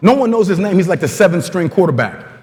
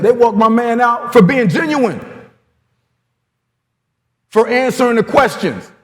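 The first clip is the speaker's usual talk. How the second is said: defiant player; 8 semitones higher than usual. Retold or imitated. Player taunts his coach about race, high taunting voice. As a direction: needle the coach like a cocky rookie.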